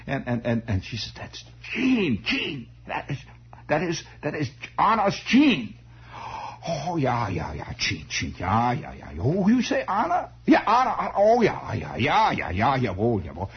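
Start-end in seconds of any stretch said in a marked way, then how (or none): 1.2-5.8 put-on high-pitched old aunt with a German accent. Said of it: "they all had this vague German accent". 6.7-13.5 put-on swooping befuddled old German man; German accent. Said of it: "they all had this vague German accent".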